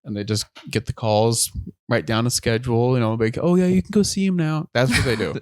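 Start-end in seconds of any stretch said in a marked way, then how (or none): 3.4-4.7 higher-pitched receptionist voice; mock secretary, lifted pitch. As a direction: play the secretary waving a visitor through. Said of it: "they sit in the secretary".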